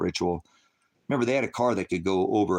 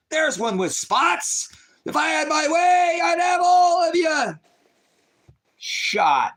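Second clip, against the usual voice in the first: much higher, loud, slow shouted Trump rant; Trump at his rallies; 6 dB louder, slower than usual. Retold or imitated. Streamer mocking Trump at his rallies, high slow shouted impression. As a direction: bellow it like a rally crowd-baiter. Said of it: "This is Trump at his rallies".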